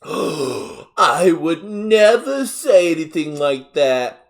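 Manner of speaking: loud, slow, high Southern indignation; hammed-up Southern outrage, drawn out; thick Southern accent. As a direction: deny it like a scandalized Southern mother. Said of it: "extremely thick, high-pitched Southern accent".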